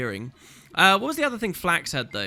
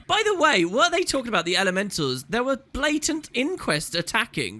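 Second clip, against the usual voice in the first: higher-pitched, put-out complaint; indignant councillor; 6 semitones higher than usual. Acted. Councillor complaining to the savant about a ruined outfit, indignant. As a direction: scold in mock outrage.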